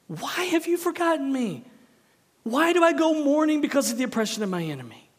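high, pressing plea to God; anguished lament. Acted. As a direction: cry out the psalm's complaint. Preacher voices psalm of lament.

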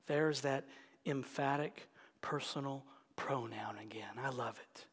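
delighted hushed aside; whisper. Acted. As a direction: share a secret delight, whispered. Savoring the pronoun in hush.

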